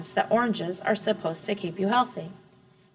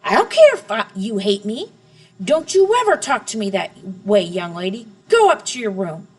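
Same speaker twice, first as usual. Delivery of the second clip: furious father; 7 dB louder than usual. A narrator's reading. Father ordering his daughter to her room, loud. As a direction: bark it down at her.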